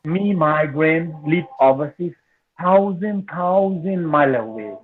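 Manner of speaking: slow, measured poetry reading with long pauses; poem recitation. Acted. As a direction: recite each short phrase deliberately, letting it hang. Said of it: "I'm trying to reciting my poems".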